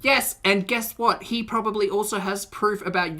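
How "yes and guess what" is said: usual speaking voice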